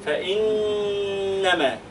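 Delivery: "فإنما" said very slowly with the nun held; drawn out with the ghunna; slow recitation. Shown as a demonstration. Teacher models the word slowly for the student. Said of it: "teacher models the word".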